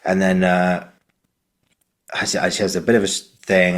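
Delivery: normal talking voice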